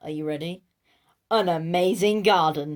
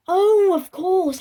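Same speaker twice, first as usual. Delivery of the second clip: much higher, louder, slow mock gasp of remembering; hammed-up realisation; 12 semitones higher, 10 dB louder, slower than usual. Narrator pretending to remember the dog, hammed-up surprise. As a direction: play the sudden remembering big.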